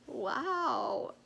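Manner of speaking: retold first stunned wow. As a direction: take it in, mildly stunned. Stunned reaction to mean replies.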